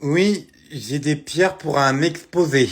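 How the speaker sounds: loud, slow lisp; lisped excuse, drawn out and loud. Acted. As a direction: force the words out past a sore tongue piercing. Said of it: "Pourquoi tu zozotes?".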